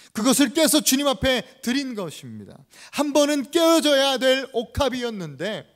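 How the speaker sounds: loud, emphatic pulpit delivery; forceful preaching. Acted. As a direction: proclaim it loudly to the congregation. (힘주어 외치는 설교)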